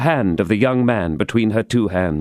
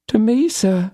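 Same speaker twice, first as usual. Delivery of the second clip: failing voice; 12 semitones higher than usual. A narrator's reading. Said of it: "in a failing voice".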